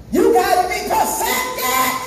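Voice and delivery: high, slow, half-sung exhortation; preacher's cry. Real, not acted. Preacher exhorts the church to perfection, high chanted cry. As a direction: raise it high and stretch every word. Preacher repeats line at full force.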